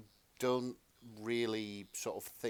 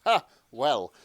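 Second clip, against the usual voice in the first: ordinary talk, nothing marked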